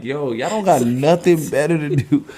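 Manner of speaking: teasing retold complaint; retold playful scolding. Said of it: "And I'm like".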